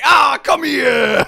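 slow, loud, eager call for a hug. Retold or imitated. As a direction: bellow it like a monster wanting a hug.

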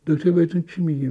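ordinary delivery

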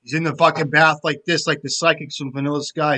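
ordinary talk

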